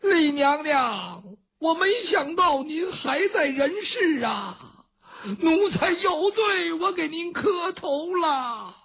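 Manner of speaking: high, slow weeping plea; a kneeling servant pleading through tears, slow and high; tearful plea. Acted. Old servant Chen Lin begs the lost empress's pardon, weeping high and slow. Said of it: "陈琳在后边这一哭".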